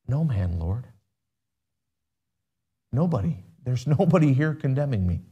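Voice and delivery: soft, halting answer after crying; hushed voice, a touch of tears; tearful, timid reply. Acted. Accused woman answering Jesus through the end of tears, softly. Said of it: "Maybe there's a quiver in her voice".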